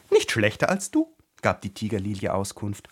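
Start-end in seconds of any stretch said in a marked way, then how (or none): 0.1-1.2 high, loud flower voice; pitched-up talking tiger lily; haughty talking flower. A narrator's reading. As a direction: answer back high and emphatic. Tiger-lily retorts to Alice.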